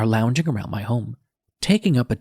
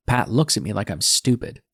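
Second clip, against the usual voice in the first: ordinary delivery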